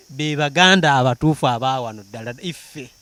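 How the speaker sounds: ordinary talk, nothing marked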